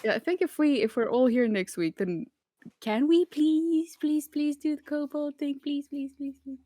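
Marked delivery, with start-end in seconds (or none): 2.8-6.6 drawn-out begging that fades away; playful pleading. Real, not acted. Begging to play the kobold game.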